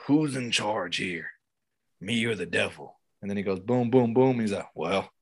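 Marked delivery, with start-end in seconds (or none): 0.1-3.0 low, slow, soft villain line; bad acting. Shown as a demonstration. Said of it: "let me hear you deliver that line". 4.8-5.1 very low, soft, slow cocky line; bad acting. Shown as a demonstration. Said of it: "let me hear you deliver that line".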